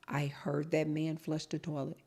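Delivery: usual speaking voice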